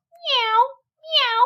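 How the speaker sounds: high mewing meow; cat meow. A narrator's reading. Simpkin the cat interrupting the mice, high meow. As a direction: mew like a cat cutting in. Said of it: "interrupted Simpkin".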